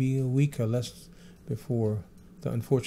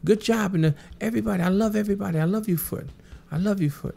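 pitched-up Michael impression, thrilled; imitating Michael; 9 semitones higher, 3 dB louder than usual. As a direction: lift the pitch into his voice, gushing. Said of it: "He said".

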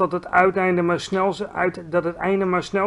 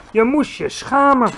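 high, indignant reproach; reproachful; 7 semitones higher, 6 dB louder than usual. A narrator's reading. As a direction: scold the other giant. GVR reproach. (hoog, verontwaardigd verwijt)

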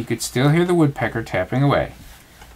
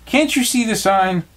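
annoyed; 10 semitones higher than usual. A narrator's reading. Rabbit annoyed.

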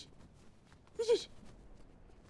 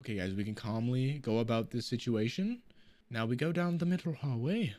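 mock calm; 10 semitones lower, 6 dB quieter than usual.

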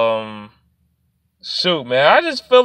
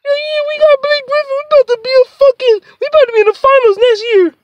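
squeaky-high, rushed falsetto; silly high mock-hype voice; mocking hopeful fans; faster than usual. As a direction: parody overexcited fans in a fast falsetto. Mocks hopeful fans, then dismisses them.

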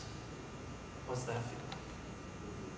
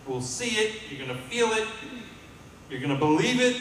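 rising fervor; 8 semitones higher than usual. Real, not acted. Preacher building to climax.

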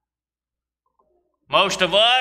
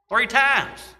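higher, hammered repeat of three times; emphatic repeat; 5 semitones higher than usual. Real, not acted. Preacher stressing Peter's three denials, higher. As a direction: drive the number home.

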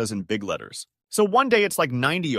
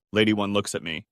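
plain reading voice

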